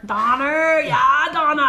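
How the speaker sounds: hollered mock Bostonian; Boston accent. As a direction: shout the name like a loud Bostonian. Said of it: "is Boston".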